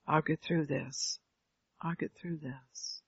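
slow, soft retold reassurance; quiet resolve. Grandson telling grandmother he will cope, quiet resolve.

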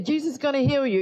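high-pitched mock assurance; mimicked healing promise. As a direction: imitate someone confidently promising healing.

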